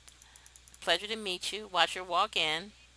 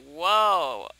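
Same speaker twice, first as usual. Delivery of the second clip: imitating the Sim; 2 semitones lower, 8 dB louder than usual. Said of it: "I can't believe he went".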